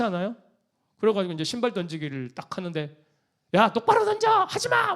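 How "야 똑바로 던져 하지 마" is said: high, loud barked yell at the players; angry pastor yelling. Retold or imitated. Pastor yelling at members during the shoe-throwing game, loud and high. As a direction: snap at them to throw straight. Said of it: "막 이렇게 하면".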